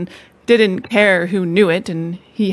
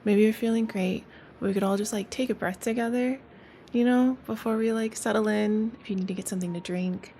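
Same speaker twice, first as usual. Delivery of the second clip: soft, gentle relaxation voice; guided relaxation; 7 dB quieter than usual. Acted. Host settling listeners before the story, soft guided voice. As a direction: ease listeners into rest softly. Calming breathing intro.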